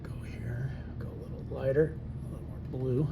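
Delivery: whispering to himself; hushed unvoiced murmur. Real, not acted. Whispers while painting.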